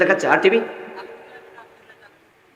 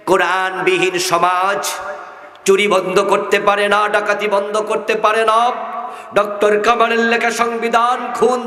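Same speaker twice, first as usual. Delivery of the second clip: rising fiery preaching; 9 semitones higher than usual. Real, not acted. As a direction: preach harder line by line. Fiery sermon building to climax.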